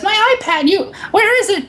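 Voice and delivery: very high, quavering mock old lady; furious old lady. Retelling the Apple store story, a very high mock furious old lady. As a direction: squawk like an outraged old customer. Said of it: "She says".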